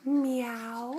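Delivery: quiet little cat meow; soft meow. Pretend. A parent meowing softly to the toddler. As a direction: meow it softly, almost to yourself.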